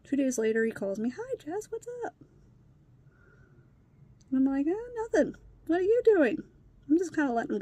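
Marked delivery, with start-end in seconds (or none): 1.1-2.1 high, soft imitated kid on the phone; lightly mocked high little voice; stepson's phone greeting. Stepson opening a phone call. 4.7-6.4 high-pitched put-on nonchalance; imitated airy small talk; feigned casualness. Put-on casual reply on phone.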